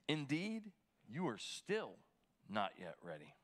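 measured, solemn Bible reading; scripture reading. Acted. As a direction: read the verses with weight. Pastor reads scripture aloud.

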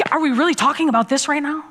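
fast, exasperated retort; irritated retort. Retold or imitated. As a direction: snap back at the husband's question. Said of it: "I'm like".

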